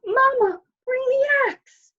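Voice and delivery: put-on very high, loud child's shout; little boy calling his mother for help; cried out. A narrator's reading. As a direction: yell for mother in a panic. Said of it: "cried out".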